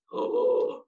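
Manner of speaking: sharp high theatrical gasp; big mock gasp. Teacher reacting to the newspaper for kids, hammed-up gasp. Shocked by no more tacos.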